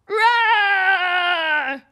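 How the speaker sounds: loud, full-throated mock roar; monster roar. Shown as a demonstration. Host leading kids in a roar, loud monster roar. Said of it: "Ready? One, two, three".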